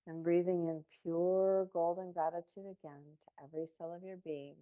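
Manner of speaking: soft, unhurried guided meditation voice; calm meditative tone. Real, not acted. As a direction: ease them gently into each breath. Leads a guided breathing meditation.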